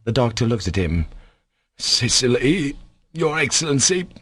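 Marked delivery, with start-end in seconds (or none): 1.8-4.1 mildly faltering, raised-pitch protest. A narrator's reading. Captain answers the doctor's Sicily advice, faltering. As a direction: falter as if taken aback. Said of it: "faltered the captain".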